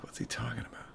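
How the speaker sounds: a puzzled unvoiced whisper. Host confused by a chat comment, whispering. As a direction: breathe it out, baffled. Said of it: "And I was like".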